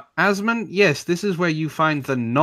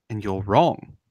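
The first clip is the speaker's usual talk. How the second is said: ordinary talk, nothing marked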